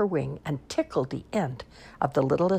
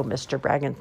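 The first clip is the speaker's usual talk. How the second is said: ordinary delivery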